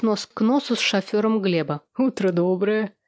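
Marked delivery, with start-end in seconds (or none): 2.0-2.9 lightly voiced cheery driver; a touch of jolly morning hello; cheerful greeting. A narrator's reading. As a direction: greet the boss's wife with mild cheer. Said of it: "весело поприветствовал меня Толик".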